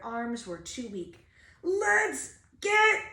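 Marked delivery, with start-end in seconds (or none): 1.6-3.0 high, loud, slow choked cry; a strangled, drawn-out panicked yell; choking panic. Acted. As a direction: gasp it out, desperate to flee. Choking burglars fleeing.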